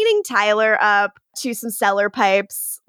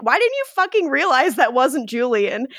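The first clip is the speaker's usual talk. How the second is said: ordinary talk, nothing marked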